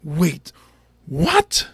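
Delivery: mock baffled outrage; intense confusion. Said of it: "that guy is like".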